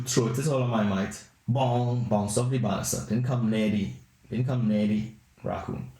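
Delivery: ordinary talk, nothing marked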